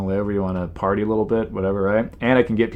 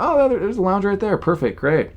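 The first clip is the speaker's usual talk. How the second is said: delighted; 10 semitones higher than usual. Retold or imitated. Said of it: "where I'm like".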